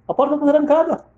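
high-pitched disbelief; shocked repeat. Retold or imitated. Wife finding herself locked out, shocked. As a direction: repeat it high, stunned by the fact.

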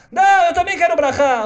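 put-on a girl at the rabbi's door; begging little girl. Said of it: "menina insiste". (menina pedindo, aguda e alta)

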